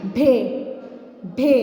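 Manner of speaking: भे said with a deep, breathy aspirated bh; heavy aspirated bhe. Shown as a demonstration. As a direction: push air out on भे like a teacher. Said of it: "इसको हम कैसे पढ़ेंगे मोटी आवाज़, भारी आवाज़ में".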